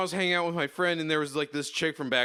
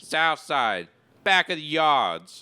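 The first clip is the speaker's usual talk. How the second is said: hammed-up Chicago accent, slow and drawn out; bad Chicago impression, leaning on each word; exaggerated Chicago accent, played for laughs; terrible Chicago accent; 3 dB louder, slower than usual. Shown as a demonstration. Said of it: "This is a terrible Chicago accent".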